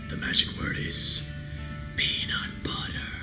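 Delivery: creepy whispered doll voice; eerie breathless doll whisper; talking doll. Acted. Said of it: "The doll goes silent".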